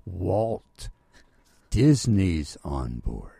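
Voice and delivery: imitating someone spelling it out slowly and loudly; each word separate, falling low at the end; very careful. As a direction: make it unmistakable, word by word. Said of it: "he said very carefully".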